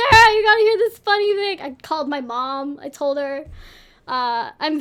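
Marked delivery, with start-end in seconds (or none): 0.1-1.6 higher, louder excited call out; excited retelling. Retold or imitated. Calling Sarah right away about the funny thing, excited. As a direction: burst out with big news for a friend.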